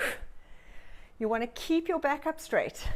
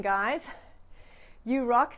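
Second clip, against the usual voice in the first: ordinary delivery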